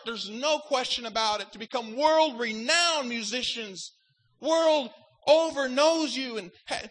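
loud, driving sermon on judgment; fervent preaching. Acted.

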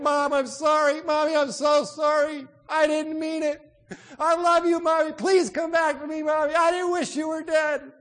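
high, loud wailed apology; anguished pleading. Acted. Daughter over her mother's casket, anguished loud pleading. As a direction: cry out in desperate, acted grief. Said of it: "kept saying over and over and over again".

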